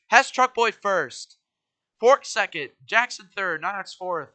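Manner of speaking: loud, slow results call; announcer. Acted. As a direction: announce the finishing order. Announcing finishing order.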